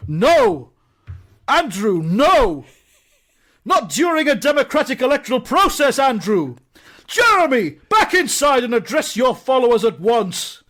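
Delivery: high, loud, commanding scolding; scandalised official bellowing reprimands; outraged alderman's shout. Acted. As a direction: call them to order, pitched right up. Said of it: "And this voice goes".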